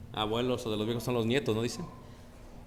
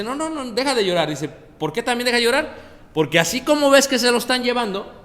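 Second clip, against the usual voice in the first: fast, high, loud preaching; 9 semitones higher, 8 dB louder than usual. Acted. Preacher voicing Jehovah telling Rachel to stop crying, loud and high.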